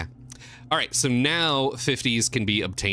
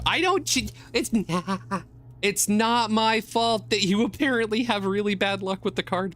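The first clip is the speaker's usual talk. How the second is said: high, giggly defensive; laughing protest; 10 semitones higher than usual. Real, not acted. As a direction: deny it through laughter.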